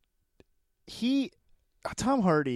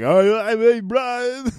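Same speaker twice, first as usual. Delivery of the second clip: slow, louder and higher dopey Eddie Brock; Eddie Brock impression; 7 semitones higher, 7 dB louder, slower than usual. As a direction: drawl it out like a clueless hero. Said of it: "he's like".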